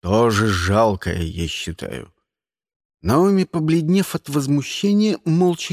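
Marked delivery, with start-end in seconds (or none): 0.0-2.1 slow, low-pitched retort; grumpy henchman. A narrator's reading. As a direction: add the insult low and slow. Karl adds a lumbering insult. (ворчит низким голосом, медленно)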